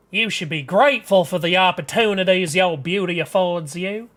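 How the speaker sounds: scolding Southern mama, emphatic; stern Southern mother. Acted. Mother warning her daughter about attitude, stern Southern accent. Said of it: "I'm making them Southern".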